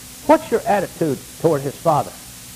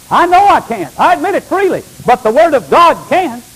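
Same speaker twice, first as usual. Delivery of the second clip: high and loud proclamation; fervent preaching; 9 semitones higher, 7 dB louder than usual. Real, not acted. Pastor answering a doubter with loud high conviction. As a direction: ring it out with conviction. Preacher rebuts imagined objection.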